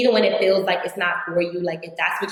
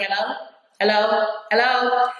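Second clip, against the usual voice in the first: repeated impatient hellos; 4 semitones higher than usual. Retold or imitated. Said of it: "I said it, like, ten times".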